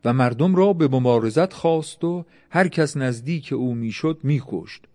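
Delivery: plain reading voice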